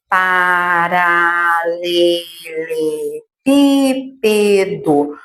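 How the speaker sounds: each syllable stretched apart, very slow. Shown as a demonstration. Teacher slowly splitting the word into syllables for the class. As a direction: spell the word out in slow beats. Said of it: "essa palavra é grande".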